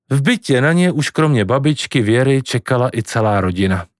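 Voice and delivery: plain reading voice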